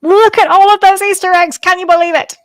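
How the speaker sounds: loud, high Easter egg announcement; big hammed-up shout of wonder; mock amazement. Host heralds the Easter egg segment, mock amazement. Calls out recurring segment opener.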